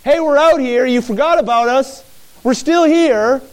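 loud, high acted shouting; calling through door. Blind men rapping on Jesus' door, shouting to him. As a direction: shout insistently to be heard inside. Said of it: "rapping on that door".

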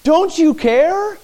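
high, slow, drawn-out plea; plaintive question. Acted. Voicing the disciples' question to Jesus, high and slow. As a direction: stretch it out, hurt and pleading. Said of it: "Someone say that for me".

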